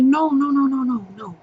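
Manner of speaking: a hushed, retold string of soothing denials; keeping composure. Godmother to scared goddaughter about her mommy, softly keeping composure. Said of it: "Trying to keep my composure".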